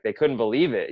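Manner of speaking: ordinary delivery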